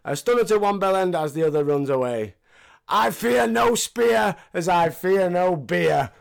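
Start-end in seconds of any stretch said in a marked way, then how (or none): 2.9-6.1 Stone Cold impression. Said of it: "line from Stone Cold".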